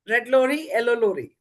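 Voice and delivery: slow, careful red lorry yellow lorry; teacher demonstrating the tongue twister slowly. Shown as a demonstration. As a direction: spell out each lorry slowly. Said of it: "Can anyone try?".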